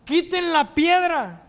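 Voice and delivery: high, slow shouted order; shouted command. Acted. Jesus orders the people at the tomb, shouted command. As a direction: call it out commandingly, stretching the words. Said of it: "le grita".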